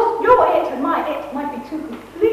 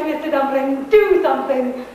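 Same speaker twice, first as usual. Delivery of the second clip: low, restrained demand; low-pitched urging; 6 semitones lower than usual. Acted. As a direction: urge him in a lower voice. Wife urges husband to act.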